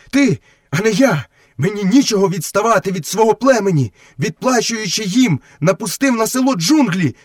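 high-pitched, heated wolf voice; wolf brother reproaching. Acted. Wolf reminding Mowgli how men wronged him, high and heated.

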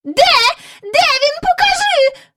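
high, gasping demands; barely catching her breath, very high; old lady gasping for news of her dog; breathless exclaim. A narrator's reading. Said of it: "вигукнула ... ледве зводячи подих".

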